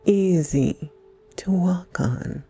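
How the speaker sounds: usual speaking voice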